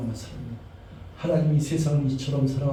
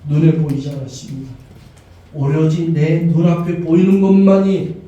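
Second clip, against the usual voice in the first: fervent prayer; 6 dB louder than usual. Acted. Pastor leading the congregation in prayer, fervent. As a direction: pray aloud for the whole church, building.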